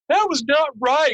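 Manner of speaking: high, slow scolding with a laugh behind it; half-laughing reproach. Retold or imitated. Telemarketer scolding the prank caller, high and slow. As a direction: tease back after being fooled. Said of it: "She goes".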